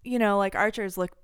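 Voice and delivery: ordinary talk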